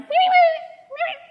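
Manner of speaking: tiny squeaky very high critter voice; cute little monster sound. Pretend. Mother voicing the invisible monster for her daughter, cute squeak. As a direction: squeak like a tiny harmless creature. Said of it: "I give it a cute little sound".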